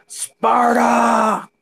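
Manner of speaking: high, shouted Sparta battle cry, drawn out; full Sparta shout. Pretend. Streamer shouts Sparta for chat, battle cry. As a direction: roar it like a warrior charging. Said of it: "say it with an intention".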